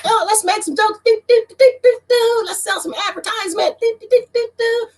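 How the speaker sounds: high, far-off mocking sing-song with do do do; mocking minstrel voice. Mocking a minstrel act for the bosses in a high voice. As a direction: parody a grinning entertainer, high. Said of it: "I can do my step and fetching like".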